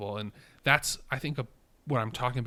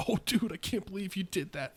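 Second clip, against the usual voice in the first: high bro voice laughing it off; a careless dude chuckling; 9 semitones higher than usual. Retold or imitated. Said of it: "And like, like".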